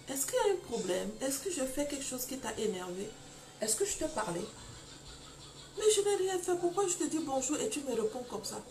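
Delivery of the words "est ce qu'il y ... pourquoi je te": timid questions. Retold or imitated. Timid questions to husband, retold.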